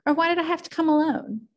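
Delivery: high, teasing Daisy voice; Daisy teasing from the car; playful Daisy. A narrator's reading. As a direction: flirt with him, lightly.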